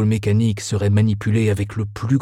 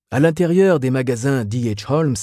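plain reading voice

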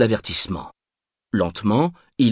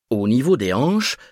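plain reading voice

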